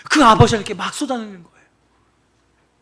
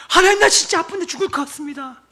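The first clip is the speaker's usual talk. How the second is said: honest plea; 7 semitones higher than usual. Retold or imitated. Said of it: "아프면 아파서 죽을 것 같다고".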